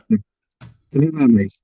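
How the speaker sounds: muffled, underwater-sounding mic; muffled audio. Real, not acted. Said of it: "you're muffled".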